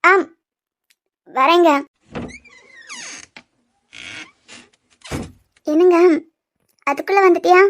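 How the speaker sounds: hide the tears but the voice gives it away; after crying. Real, not acted. Wife answering husband at the door, just after crying. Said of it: "மூஞ்செல்லாம் வீங்கி போயிருக்கு, கண்ணீர் கட்டி நிக்குது".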